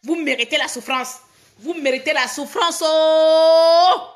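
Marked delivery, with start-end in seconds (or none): 1.6-4.0 indignant wail held at full volume; loud drawn-out cry. Real, not acted. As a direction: end on a long, held, loud "oh". Repeats the curse, wailing it out.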